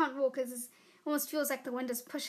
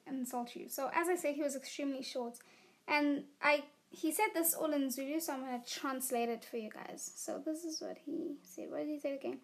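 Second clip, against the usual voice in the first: usual speaking voice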